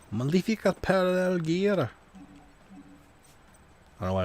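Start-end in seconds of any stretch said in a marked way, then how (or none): 0.1-1.9 put-on high, ringing Scottish accent on the card name. Announcing his card to stream in Scottish accent. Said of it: "I don't know why I went Scottish".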